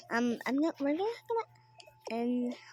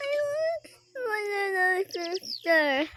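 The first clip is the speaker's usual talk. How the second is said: pretend crying; 5 semitones higher, 4 dB louder than usual. Child crying for sister.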